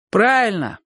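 grudging, drawn-down agreement; gloomy confirmation. A narrator's reading. Boys confirm Chik's answer to Bocho, gloomily. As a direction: concede it glumly. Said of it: "мрачно подтвердили ребята".

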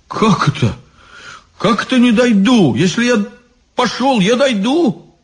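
high-pitched incredulous protest; indignant disbelief. Acted. Иван возмущён словами головы. (возмущённое недоумение)

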